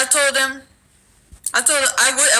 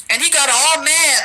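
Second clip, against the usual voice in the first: ordinary delivery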